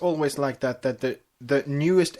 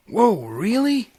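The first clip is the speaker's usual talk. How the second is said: much higher little dragon voice, slow amazed; Spike gasping; 10 semitones higher, 6 dB louder, slower than usual. Acted. Spike learns Twilight is having a foal, amazed and high. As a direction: gasp of wonder from the kid. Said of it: "Spike gasped".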